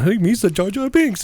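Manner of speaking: high goofy cartoon voice; squeaky silly alien; goofy Jar Jar voice. Imitating Jar Jar's silly voice.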